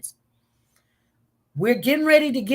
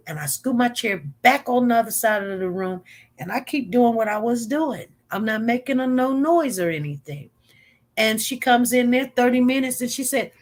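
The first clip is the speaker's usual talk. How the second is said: ordinary talk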